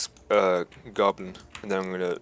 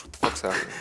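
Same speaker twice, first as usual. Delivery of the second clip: ordinary talk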